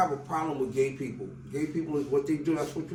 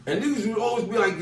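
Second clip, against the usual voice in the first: ordinary talk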